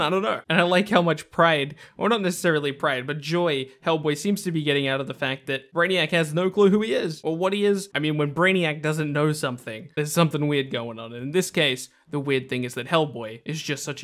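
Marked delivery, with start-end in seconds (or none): none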